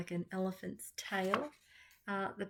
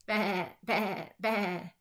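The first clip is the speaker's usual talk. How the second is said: bleating baa, baa, baa in a sheep voice; a bossy sheep baaing; firm sheep bleat; 2 semitones higher, 3 dB louder, much slower than usual. A narrator's reading. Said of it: "Pete said firmly".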